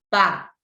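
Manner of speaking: pá dito sozinho, forte e alto; isolated stressed syllable. Shown as a demonstration. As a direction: destaque o pá. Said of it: "A sílaba pá tá sendo a mais forte". (pá isolado e acentuado)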